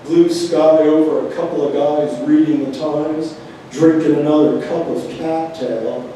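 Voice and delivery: measured free verse with pauses between images; poem reading. Acted. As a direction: recite each line as its own phrase. Reads a poem aloud.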